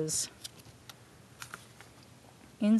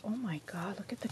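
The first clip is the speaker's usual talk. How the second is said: whispering; 10 dB quieter, much faster than usual. Real, not acted. Whispered awe at book.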